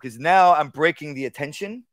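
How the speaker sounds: normal talking voice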